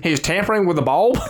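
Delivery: mock tattling busybody; hammed-up nosy neighbour; put-on snitch voice. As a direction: inform on him in a silly accent. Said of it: "both putting on this accent".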